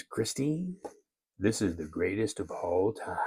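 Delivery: whispered greatest-of-all-time line; Muhammad Ali whisper. Retold or imitated. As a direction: breathe the boast like the champ, in a whisper. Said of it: "I do a very bad Muhammad Ali imitation".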